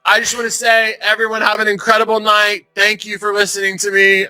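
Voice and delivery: high mock farewell speech; hammed-up heckler's goodbye, pitched high; imitated ousted heckler. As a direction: sign off grandly while being dragged away. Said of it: "as she's getting dragged out".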